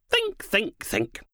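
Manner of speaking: leaping high on the first think, then trailing off; mock pondering, tapping the forehead; patronising nudge to a slow colleague; teasing.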